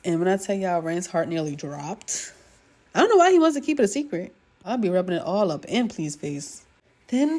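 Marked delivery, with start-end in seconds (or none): 2.9-4.3 fast, loud, higher exasperated outburst; exasperated rant. Real, not acted. Vlogger ranting to viewers about the secret, fast and loud. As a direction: rattle it off, fed up with him.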